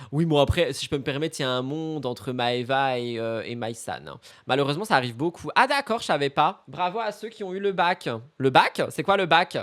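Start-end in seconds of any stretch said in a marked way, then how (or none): none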